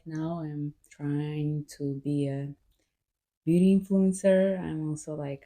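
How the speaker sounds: ordinary talk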